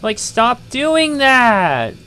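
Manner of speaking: dragged-out, furious stop doing that; frustrated yell. Real, not acted. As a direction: yell at the screen as the controls fail. Game controls failing, player yelling.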